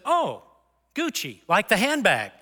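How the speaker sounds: high-pitched, swooping "Oh" of recognition; mock surprised realization. Officer hears the spelled name Gucci, retold in high-pitched surprise. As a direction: play the officer suddenly getting it.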